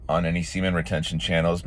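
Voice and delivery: ordinary talk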